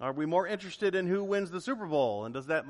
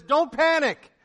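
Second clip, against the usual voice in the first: emphatic command; 10 semitones higher, 11 dB louder, slower than usual. Retold or imitated. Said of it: "But he says".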